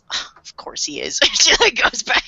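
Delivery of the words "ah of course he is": soft, lower, half-whispered shrug; muttered aside. Retold or imitated. Mom shrugging off the anime killing, under her breath. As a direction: let it slip out under your breath. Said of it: "She goes".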